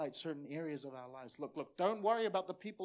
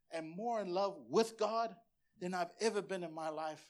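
ordinary talk

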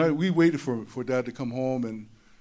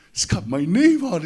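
louder excited cry of "name"; excited boy; 5 semitones higher, 8 dB louder than usual. Retold or imitated. Little boy shows off his trophy, loud and thrilled. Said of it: "oh, man, I was so excited".

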